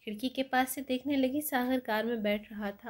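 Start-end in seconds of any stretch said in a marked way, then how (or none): none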